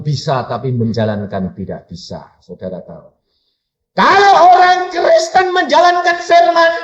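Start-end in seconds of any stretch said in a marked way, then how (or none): 3.9-6.7 high, loud proclamation; full-force pulpit cry; preaching shout. Real, not acted. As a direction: ring it out over the congregation. Preacher proclaims promise to congregation.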